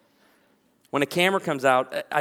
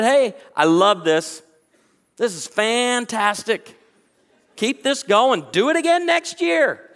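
enthusiastic praise; 7 semitones higher, 7 dB louder, slower than usual. Retold or imitated. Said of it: "I stopped at their table and said".

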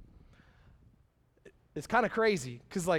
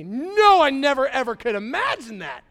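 much higher and louder, hammed-up outrage; mock shocked refusal; 5 semitones higher, 10 dB louder than usual. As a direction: ham up a horrified no.

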